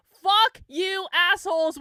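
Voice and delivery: loud, high-pitched furious retort; angry yelling. Real, not acted. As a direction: hurl it at the critics. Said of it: "Why are you yelling?".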